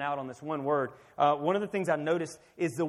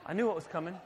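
ordinary delivery

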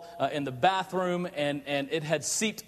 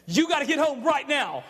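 urgent wife; 7 semitones higher, 3 dB louder than usual. Retold or imitated. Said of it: "I can always tell when something's going on in my wife's voice".

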